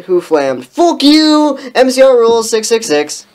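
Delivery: high, loud mock rant; ranting author's note. Fan fiction author to her flamers, loud rant. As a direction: rail at the haters at full volume.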